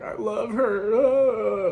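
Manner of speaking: high, drawn-out mock wail; mock crying. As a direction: whine it out like a hammed-up sob. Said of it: "I'm, like, crying".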